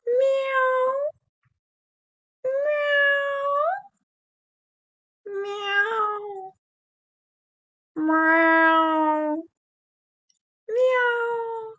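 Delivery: high mewing cat meows; repeated high cat meows. Shown as a demonstration. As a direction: mew like a kitten, high and drawn out. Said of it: "let's start with this sound".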